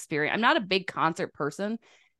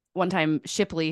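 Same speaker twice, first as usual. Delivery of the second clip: ordinary talk, nothing marked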